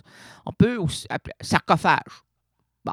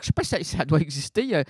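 ordinary delivery

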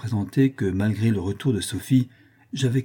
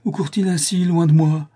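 high cry growing louder; Sophie's raised, anguished call; 8 semitones higher, 5 dB louder than usual. A narrator's reading. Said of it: "s'écrie Sophie".

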